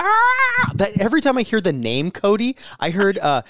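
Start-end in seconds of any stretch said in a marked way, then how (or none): 0.0-0.7 very high, loud dog shriek; piercing mock dog scream; imitated dog scream. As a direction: scream like the Shih Tzu. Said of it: "and he go".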